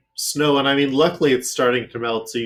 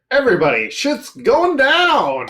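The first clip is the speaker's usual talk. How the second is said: shouting; 14 semitones higher, 8 dB louder than usual. Acted. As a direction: yell it as chaos breaks. GM announces sudden blizzard.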